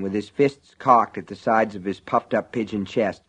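usual speaking voice

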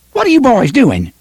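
high, sharp woman's call. Acted. Woman scolding the boys across the market, sharp call. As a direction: catch them in the act. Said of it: "a woman's voice called sharply".

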